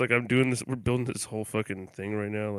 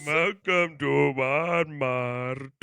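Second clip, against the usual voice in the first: mock Indian accent; 3 semitones higher, much slower than usual. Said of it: "He doesn't do the R rolls right".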